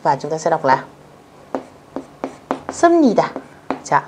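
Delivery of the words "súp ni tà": Súp ni tà with the p turned to m, high; pronunciation demo. Shown as a demonstration. Teacher showing the b-to-m sound change. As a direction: say Súp ni tà as sưm-ni-tà. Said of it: "phải đọc thành chữ M".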